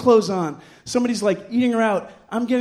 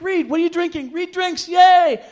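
high-pitched cheering, bursting into a loud yay; party cheer; 8 semitones higher, 7 dB louder than usual. Retold or imitated. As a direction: imitate friends whooping on a drinker. Friends cheering on his drinking.

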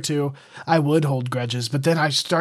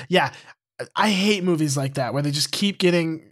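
usual speaking voice

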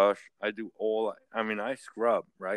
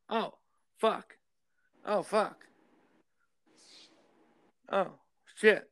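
mock dismayed wife; 9 semitones higher, slower than usual. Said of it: "They're like".

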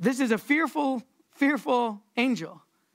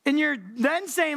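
ordinary delivery

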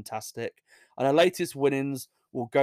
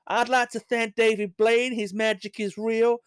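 mock acceptance speech; 9 semitones higher than usual.

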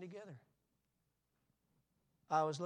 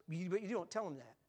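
ordinary talk, nothing marked

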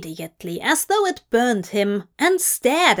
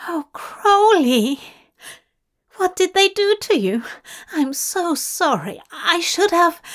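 high, rushed outpouring of horrified sympathy; distressed concern; 8 semitones higher than usual. Acted. Angel cradling Crowley after seeing him, distressed concern. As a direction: fuss over a hurt friend, voice pitched up. Angel pities tortured friend.

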